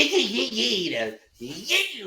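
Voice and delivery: high, drawn-out squealed "yee"; squealy mock swing sounds; imitated swing noise. Mimicking odd on-deck swing noises.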